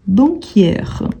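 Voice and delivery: ordinary talk, nothing marked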